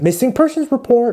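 high-pitched put-on American accent. Shown as a demonstration. Vlogger showing off for viewers, American accent. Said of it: "What do you guys think of my American accent?".

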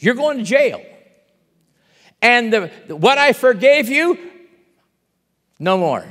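loud, thundering judgment; king condemning the wicked servant loudly; furious sentence. Acted.

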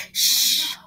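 sharp hushing shh; loud shush. Acted. Monkey hushing the others, loud shush. Hushing to not wake Mama.

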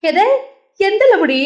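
high startled cry of disbelief; high shocked outburst. A narrator's reading. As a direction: blurt it out in shock. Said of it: "அதிர்ச்சியானான்".

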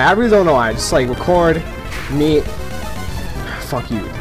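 ordinary talk, nothing marked

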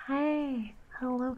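ordinary delivery

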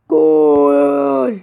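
loud excited goal cry; goal shout. Real, not acted. Gamer scoring a goal against the computer, loud excited shout. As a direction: shout it as the ball goes in.

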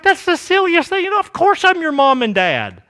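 high-pitched incredulous parent; reassuring parent. Retold or imitated. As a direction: brush off a child's silly worry. Parent dismissing child's adoption worry.

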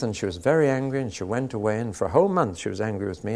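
ordinary talk

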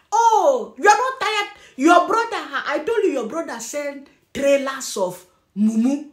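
ordinary talk, nothing marked

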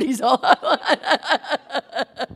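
slow words dissolving into a long laugh; breaking into laughter. Real, not acted. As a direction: lose the end of the line to laughter. Laughs while retelling own quote.

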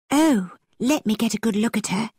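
eager cry. A narrator's reading. Isabella wants to see Henry's sister, an eager cry. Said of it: "cried Isabella".

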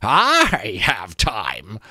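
very slow, musing creak; reflective skull. A narrator's reading. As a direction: drag it out, lost in thought. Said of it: "the skull replied reflectively".

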